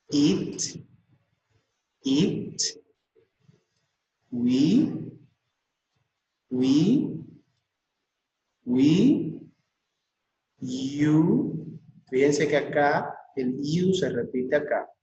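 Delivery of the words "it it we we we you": IT, WE, YOU each alone, rising at the end; drawn-out rising WE between pauses; pronunciation drill. Shown as a demonstration. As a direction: model each word for students. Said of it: "pues vuelvo a repetir".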